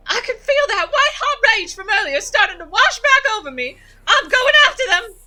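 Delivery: high enraged cry; furious Gretchen. Acted. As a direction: scream at the mongrels to keep off. Voices Gretchen in game.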